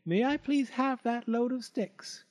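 high, imploring piglet voice; high-pitched little pig plea; pleading pig. A narrator's reading. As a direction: beg politely, pitched high. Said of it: "pleaded the pig".

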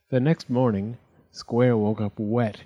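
ordinary delivery, nothing marked

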